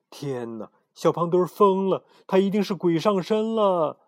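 high, loud alarmed exclaim. Acted. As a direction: gasp out that he's possessed. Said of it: "贝里奥惊呼".

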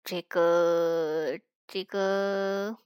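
low, soft, drawn-out hemming; bashful hesitation. A narrator's reading. Brother too shy to accept the offer, hesitant and low. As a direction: stall sheepishly, stretching each word.

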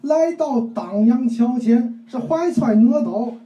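slow, emphatic mock storyteller in Tianjin dialect; 天津话评书腔; Tianjin-dialect storytelling. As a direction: drag out the phrases like a Tianjin storyteller. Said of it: "那你给学学".

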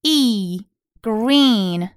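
"green" with a long, held E. Shown as a demonstration. Teacher explains the double E, long vowel in "green". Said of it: "两个E发的是长".

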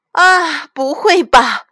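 loud, high gasp of disbelief; shocked cry. A narrator's reading. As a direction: cry out as mum finding the damage. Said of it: "妈妈惊呼起来". (妈妈又高又响地惊呼)

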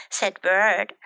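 lively, animated picture-book reading; children's story narration. A narrator's reading. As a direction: read it to small children with bouncy character lines.

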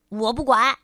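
put-on cranky child snapping back; stubborn little crocodile losing patience; irritated. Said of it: "琪琪烦躁地说".

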